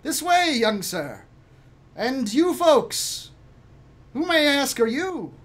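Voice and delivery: high, lilting formal voice; high-pitched, polite servant; gentleman servant. Acted. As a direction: show them in, drawing out the questions. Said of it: "the gentleman says".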